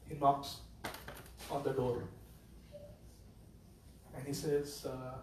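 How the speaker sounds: usual speaking voice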